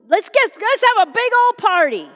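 loud, high celebratory call; exuberant father. Acted. Father insisting on a party for his son, exuberant. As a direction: whoop it up and overrule him.

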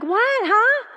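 high, rising bewilderment; baffled. Retold or imitated. Mother failing to follow her daughter's code, baffled. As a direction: squeak it out, totally lost. Retelling own bafflement at the clue.

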